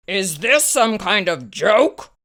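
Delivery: snapped. A narrator's reading. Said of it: "snapped the vet".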